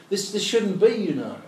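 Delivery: high, loud affronted protest; indignant. Retold or imitated. Teacher voicing God's affront at sin, high loud protest. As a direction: sound personally affronted, pitched high.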